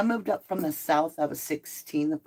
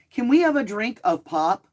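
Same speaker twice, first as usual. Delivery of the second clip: higher, louder teasing imitation of a Northern accent; mock Northern accent; 4 semitones higher, 6 dB louder than usual. Southern folks teasing her about how she sounds, mock Northern accent. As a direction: mimic a relative's Northern accent. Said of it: "That's what you sound like".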